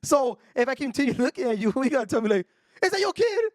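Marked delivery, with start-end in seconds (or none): 2.8-3.4 very high-pitched mock yell; imitated screaming woman. Screaming woman asking the man staring at her, mock shriek. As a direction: mimic her shrieked challenge. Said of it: "she was screaming loud".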